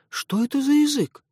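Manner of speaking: very high, amazed question; surprised. A narrator's reading. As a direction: gasp it out in wonder. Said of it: "удивлённо спросила Фи". (удивлённо)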